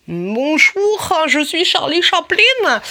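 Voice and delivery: loud, high, drawn-out mock German accent; hammed-up German-accented self-introduction, pushed loud and high; Charlie Chaplin, accent allemand. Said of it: "Charlie Chaplin avec un accent allemand". (accent allemand exagéré, fort et aigu)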